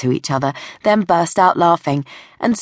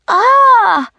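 high, loud startled scream; piercing girlish "Ah!"; teenage girl's shriek; 7 dB louder than usual. Acted. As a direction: shriek as a muddy dog leaps on you. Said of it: "the teenage girl shrieked".

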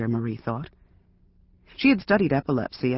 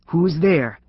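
a loud bark through the door, louder; big barking voice; 3 semitones higher, 5 dB louder than usual. Acted. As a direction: bark the question like a gruff man. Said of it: "in a big barking voice".

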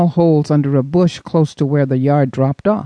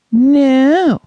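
high, louder mock of his sister's disgust; mocking appalled tone; 11 semitones higher, 5 dB louder than usual. Boy mimicking his sister's appalled tone. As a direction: throw her appalled No right back at her. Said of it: "mimicking his sister's appalled tone".

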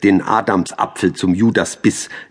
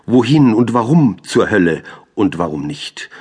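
loud, high-pitched declaimed questions; exasperated challenge; 4 semitones higher, 5 dB louder than usual. Acted. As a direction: hurl the questions out. Poem's cursing, challenging questions. (laut und aufgebracht fragend)

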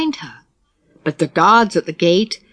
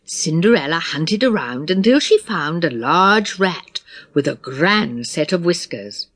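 usual speaking voice

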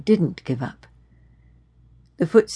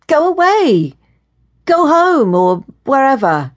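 loud, high, irritated order; exasperated dismissal; 8 semitones higher, 6 dB louder than usual. A narrator's reading. Woman telling her unwelcome follower to leave, exasperated. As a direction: send him packing, voice raised.

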